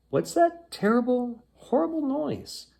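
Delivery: put-on a bright-eyed sea girl, startled; mermaid voice. Mermaid voice, much higher.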